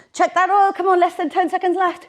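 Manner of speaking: high, loud coaching push; rally them loudly to the finish; loud encouragement. Real, not acted. Coach pushing through final seconds.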